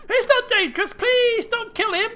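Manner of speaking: very high, loud plea; desperate pleading. Acted. Daughter begging her father to spare the bear, desperate. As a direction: beg shrilly for a life. Daughter begs for bear's life.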